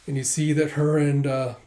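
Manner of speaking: ordinary delivery, nothing marked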